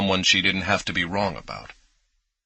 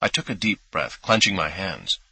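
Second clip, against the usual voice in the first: ordinary delivery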